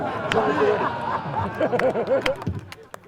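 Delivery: high laugh running on; helpless laughter. Real, not acted. Storyteller cracks up as the man gets back in the car, helpless laughter. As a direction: burst out cracking up.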